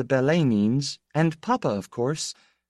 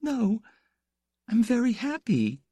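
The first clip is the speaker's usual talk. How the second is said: sobbing. A narrator's reading. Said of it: "Piera sobbed".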